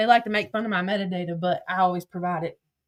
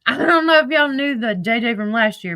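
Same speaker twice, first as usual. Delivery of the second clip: ordinary delivery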